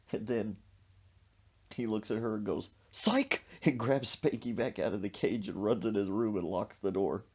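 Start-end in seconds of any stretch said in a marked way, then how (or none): 2.9-3.4 high, sudden mocking cry; spring the trick with a high yelp; gotcha taunt. Grandson's taunt in story.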